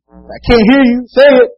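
shouting I can't hear you; shouted demand. Retold or imitated. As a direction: yell it to make him repeat. Rod demanding louder.

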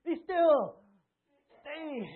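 put-on very loud, high yell at running kids; frazzled yell at kids. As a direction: bellow it over a crowd of children. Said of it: "and you're like".

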